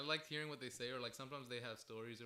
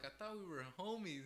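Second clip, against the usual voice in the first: retold hurt plea; 6 semitones higher than usual.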